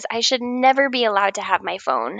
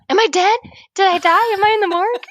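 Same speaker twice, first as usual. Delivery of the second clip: much higher, louder mock alarm; retold yell; 9 semitones higher, 4 dB louder, faster than usual. Patient waking up once more in post-op, mock yelling out. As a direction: replay the panicked wake-up cry, hammed up. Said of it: "and immediately yell out".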